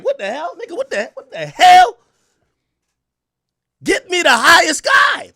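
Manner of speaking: high, loud, slowed yelling with a laugh; exasperated shout. Retold or imitated. Streamer yelling at an internet tech for the highest guy, loud and half laughing. Demanding a supervisor.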